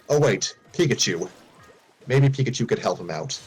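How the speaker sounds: ordinary delivery, nothing marked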